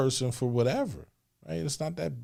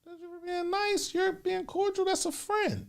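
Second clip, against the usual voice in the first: high mocking voice; slower than usual. Arguing, mock voice.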